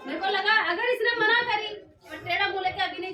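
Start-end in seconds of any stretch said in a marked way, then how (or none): 0.1-1.7 loud laughing telling. Real, not acted. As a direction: laugh while you tell it. Recalling her test of husband.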